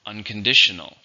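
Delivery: Unconditional, loud on the DI syllable; stress on DI. Shown as a demonstration. Teacher models Unconditional for learners, stress on DI. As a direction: say Unconditional as un-con-DI-tion-al. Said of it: "stress on the second".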